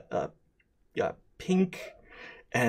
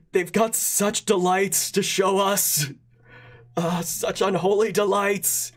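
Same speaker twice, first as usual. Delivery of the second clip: higher, stammering halfling voice; 4 semitones higher than usual. Acted. Small halfling introducing himself to the Goblin Prince, stammering. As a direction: stutter eagerly like a nervous little halfling. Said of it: "the little halfling says".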